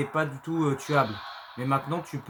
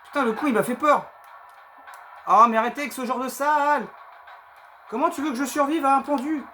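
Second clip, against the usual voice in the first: panicking gamer; 9 semitones higher, 4 dB louder than usual. Real, not acted. After scare, still shaken.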